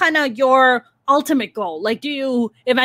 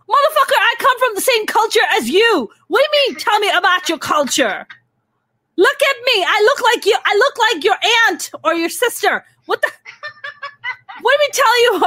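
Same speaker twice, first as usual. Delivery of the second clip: indignant rant; 10 semitones higher than usual. Acted. Ranting to April about DM guys, high and outraged. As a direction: vent in disbelief at the absurd DMs.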